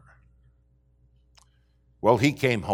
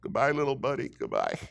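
mock sad farewell; 4 semitones higher than usual. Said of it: "like they're saying goodbye to a friend".

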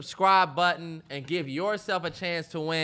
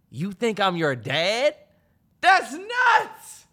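mock outrage; 2 semitones higher, 4 dB louder, much slower than usual.

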